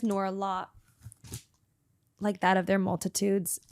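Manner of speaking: soft, earnest prayer recitation; prayer reading. Acted. Praying the Aleinu to the audience, soft and devout. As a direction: read it like davening from the heart.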